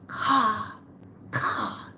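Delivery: breathy imitated caw, voice dropping out; raven caw. Raven locking eyes with the teller, cawing. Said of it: "and it goes".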